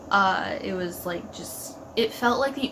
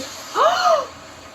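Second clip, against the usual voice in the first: shocked gasp; 3 dB louder than usual. Real, not acted. Shocked by the scene.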